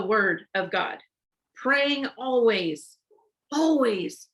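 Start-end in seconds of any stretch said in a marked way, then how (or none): 1.5-4.3 "Praying always" slowed right down, then "always" again higher; slow emphasis. Shown as a demonstration. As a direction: dwell on "always", slow and pressing. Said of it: "slow emphatic repetition".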